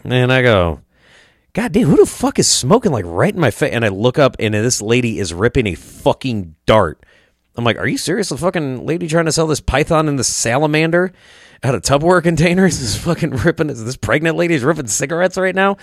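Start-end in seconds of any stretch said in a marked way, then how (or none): 1.5-3.7 high-pitched, exasperated complaint; retold indignant outburst. Man smelling smoke at a market, indignant outburst. 7.8-15.8 high-pitched, appalled disbelief; retold incredulity. Visitor appalled by pregnant seller smoking, incredulous.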